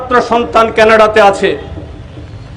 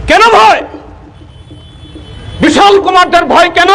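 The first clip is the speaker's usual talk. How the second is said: emphatic outcry; 8 semitones higher than usual. Real, not acted. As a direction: hurl the question at the crowd. Rhetorical question repeated.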